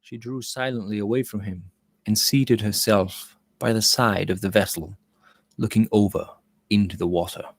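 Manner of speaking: even, measured storybook reading; story narration. A narrator's reading. Narrator setting the deck scene, calm story reading. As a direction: read it like a novel aloud.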